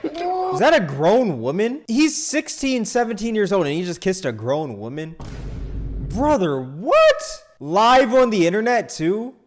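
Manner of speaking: high, loud disbelief; stunned outburst, questions leaping upward; incredulous. Real, not acted. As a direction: react in loud, scandalized shock. Shocked reaction to a clip.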